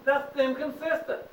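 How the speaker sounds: preaching hard. Real, not acted. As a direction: preach it, full force, to the class. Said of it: "I'm gonna preach a minute now".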